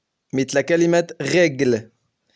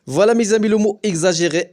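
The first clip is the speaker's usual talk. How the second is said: ordinary delivery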